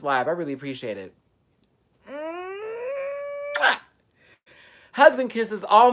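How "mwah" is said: high smacking kiss sound; loud air kiss. Real, not acted. Blowing a kiss to viewers signing off. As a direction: blow the viewers a big kiss. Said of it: "blowing viewers a kiss".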